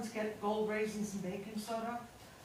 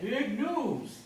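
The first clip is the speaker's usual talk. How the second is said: imitating George; 2 semitones higher, 3 dB louder than usual. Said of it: "That's how he said it".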